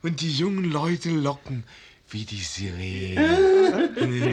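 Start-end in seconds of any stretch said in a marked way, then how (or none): 2.1-4.3 lachend schwärmend. Acted.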